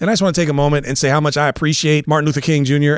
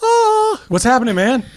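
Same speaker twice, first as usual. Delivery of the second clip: high-pitched greeting impression; raised, put-on hello; Scott; 12 semitones higher than usual. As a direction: imitate a buddy in a high voice. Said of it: "do my impression of, uh, Scott".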